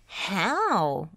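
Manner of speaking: plain reading voice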